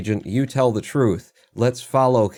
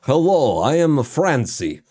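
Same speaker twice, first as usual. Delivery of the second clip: higher, louder mock French accent; bad French accent; 4 semitones higher, 4 dB louder, slower than usual. Shown as a demonstration. Showing listeners an attempted French accent, hammed-up French accent. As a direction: ham up a French accent that misses. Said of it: "You wanna hear my French accent?".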